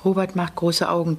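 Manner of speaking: ordinary delivery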